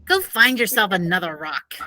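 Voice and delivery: ordinary delivery, nothing marked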